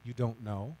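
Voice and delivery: soft, low hurt question; wife's quiet reproach. Retold or imitated. Wife in the living room asking her husband, softly hurt. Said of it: "and she says".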